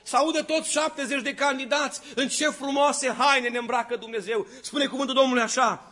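high, loud fervent preacher's voice; full-force preaching. Acted. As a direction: preach it with burning fervor to the whole hall. Preacher exhorts congregation at full force.

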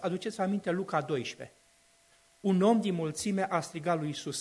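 ordinary talk, nothing marked

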